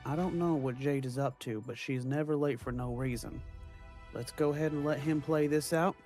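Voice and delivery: ordinary talk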